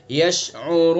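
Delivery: यशउरून with the ऊ held four alifs; four-alif stretch. Shown as a demonstration. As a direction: hold the ऊ in यशउरून for four counts. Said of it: "चार अलिफ". (यशउरून चार अलिफ खींचकर)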